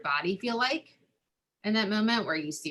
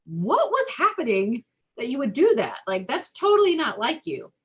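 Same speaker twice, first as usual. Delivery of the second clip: higher, louder caring surprise; concerned parent; 6 semitones higher, 5 dB louder than usual. Retold or imitated. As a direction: gently ask the child what happened, voice lifted high. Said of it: "rather than, like".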